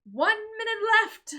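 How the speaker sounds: high, loud announcement to the crowd; mayor shouting to the crowd; calling out. Acted. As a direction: call it across the square.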